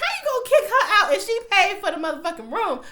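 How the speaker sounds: high, loud, fast indignant shout; shrieking disbelief at the screen; outraged laughing yell. Retold or imitated. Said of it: "I'm like".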